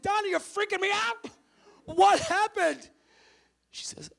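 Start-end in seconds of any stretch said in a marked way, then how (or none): 0.0-2.9 high, loud frantic demand; acted freaked-out outburst; panicked shout. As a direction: yell it in alarm, stretching the last words.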